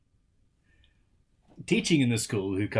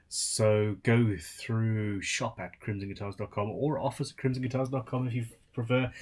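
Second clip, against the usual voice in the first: normal talking voice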